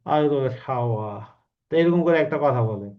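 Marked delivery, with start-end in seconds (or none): 0.1-1.1 a hint of a menacing film villain, slowed on আওয়ায় বেড়েছিস; lightly voiced film hero's threat; Manna film dialogue. Teacher imitating Manna's screen threat, lightly menacing. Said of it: "মান্নার মুভিতে dialogue গুলো এরকম".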